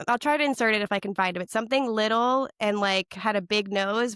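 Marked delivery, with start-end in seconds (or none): none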